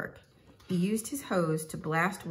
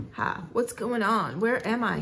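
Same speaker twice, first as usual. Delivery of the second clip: confused; 5 semitones higher than usual. A narrator's reading. Patient wakes in the operating room, mildly confused. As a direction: ask it with a touch of disorientation. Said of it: "he asked, confused".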